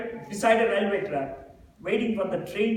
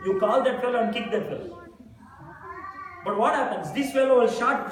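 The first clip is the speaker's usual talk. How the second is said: ordinary talk, nothing marked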